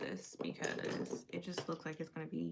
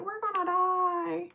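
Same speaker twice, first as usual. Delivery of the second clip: high, loud, drawn-out panicked wail; mock freaking out; 11 semitones higher, 6 dB louder, slower than usual. Companion caught in hail, imitated high panicked wail. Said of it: "Yako was over here freaking out".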